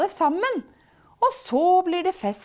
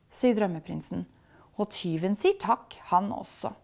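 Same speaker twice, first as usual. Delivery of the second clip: ordinary delivery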